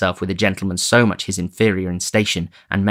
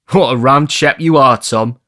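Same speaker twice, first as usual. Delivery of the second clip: put-on louder, highly amused boy; 5 semitones higher, 7 dB louder than usual. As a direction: tease him merrily. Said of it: "highly amused".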